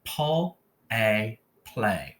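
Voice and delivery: "Pull", "a", then blended "play"; sounded out. Shown as a demonstration. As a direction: stretch "pl" and long "a" apart, then say "play". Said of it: "let's do just the first syllable".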